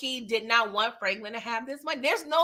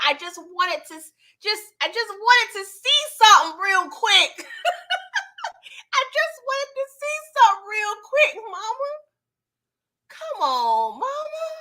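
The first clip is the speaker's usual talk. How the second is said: high, laughing whine to Mama; mock pleading; 9 semitones higher than usual. Viewer begging Mama to wait, high laughing whine. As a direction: beg like a kid, half laughing.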